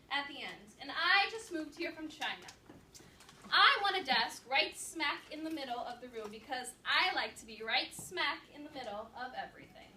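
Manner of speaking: lightly voiced plucky second grader; confident new girl. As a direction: announce yourself to the class like you own the room. Girl character introduces herself boldly.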